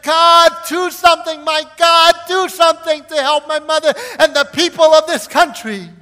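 very high, very loud cry to God; preacher reliving a desperate prayer, shouting high; desperate shouted plea. Retold or imitated. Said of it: "I plead with the Lord".

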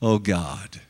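low, soft, broken moan; a low, soft, pained moan to God. Retold or imitated. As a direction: groan it out low like a man in unbearable pain. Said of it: "and he's moaning, saying".

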